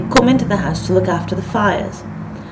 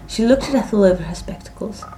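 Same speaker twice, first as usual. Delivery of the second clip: ordinary delivery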